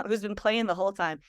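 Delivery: ordinary delivery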